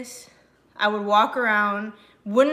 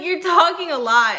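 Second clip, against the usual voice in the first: higher, much louder mocking quote; amused retelling; 7 semitones higher, 9 dB louder than usual. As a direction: laugh through the parents' line, pitched up and loud. Retells parents' remark again, laughing.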